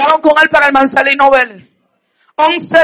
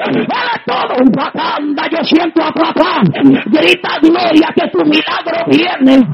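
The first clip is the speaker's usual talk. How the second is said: higher, rising preacher's cry, shouting praise; fiery preaching; 4 semitones higher, 4 dB quieter than usual. Real, not acted. Preacher testifying to the congregation, full-force preaching. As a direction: preach it out with building fervor.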